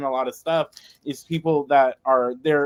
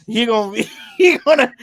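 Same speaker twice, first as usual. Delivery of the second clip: amused thought; 6 dB louder than usual. Retold or imitated. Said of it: "I'm over here thinking".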